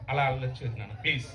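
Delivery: hushed, low coaxing plea; soft pleading. Acted. Performer begs the unruly partner not to misbehave, softly pleading. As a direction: plead gently under your voice.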